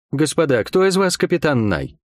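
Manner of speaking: ordinary delivery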